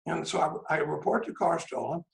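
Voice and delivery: normal talking voice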